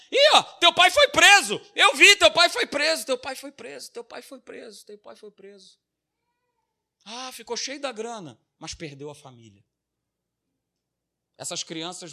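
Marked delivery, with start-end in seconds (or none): 0.1-5.8 schoolkids taunting. Retold or imitated. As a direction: jeer like kids in the schoolyard. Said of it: "a criançada não deixa por menos".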